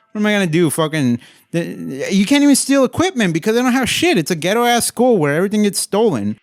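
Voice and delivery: high-pitched, worked-up imitation of a hothead; freaking-out hothead. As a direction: act out a hothead losing it over the money. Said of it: "William Forsythe freaks out".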